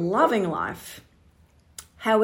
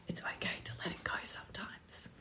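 soft reassuring whisper; 16 dB quieter, faster than usual. Real, not acted. Comforting her Manny Gens, whispered. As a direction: whisper it close, like a secret comfort. Tender aside to her viewers.

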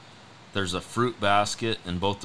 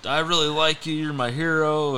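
high, gushing mock-fan voice; excited fan impersonation; 8 semitones higher, 5 dB louder than usual. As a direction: play the adoring fan, pitched well up. Acting out an excited fan in anecdote.

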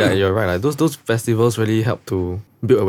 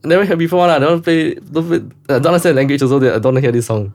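mocking friends; 5 semitones higher than usual. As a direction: whine it like a dismissive friend. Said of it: "All my other friends like".

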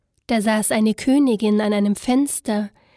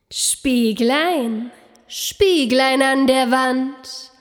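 slow, proud queen asking her mirror; vain queen voice; much slower than usual. A narrator's reading. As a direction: savour each word like a vain queen. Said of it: "sprach sie".

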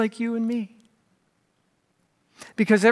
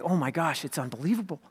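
awed hush; 5 semitones lower, 9 dB quieter than usual. Retold or imitated. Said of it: "you're like".

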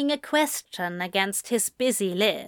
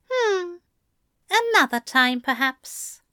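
musing; 9 semitones higher than usual. A narrator's reading. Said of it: "Aziraphale mused".

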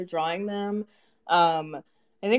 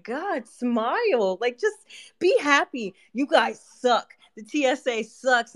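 mock exasperation; 6 semitones higher than usual. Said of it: "And I'm just like".

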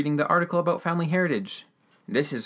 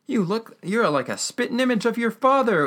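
higher-pitched old man impression; imitated old man, pitched up; 10 semitones higher than usual. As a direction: play the old guy marvelling at the likeness. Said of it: "the old guy's like".

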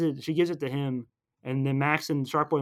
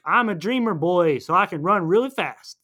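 high, loud, swooping boast; mock boasting; 5 semitones higher, 6 dB louder than usual. Recapping Max declaring his dream powers, mock-heroic. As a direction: proclaim it like a cartoon boy hero.

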